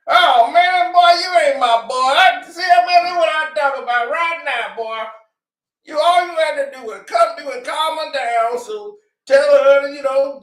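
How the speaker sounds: hammed-up father begging his famous son; pleading dad. Father begging [name] on the phone, high mock shout.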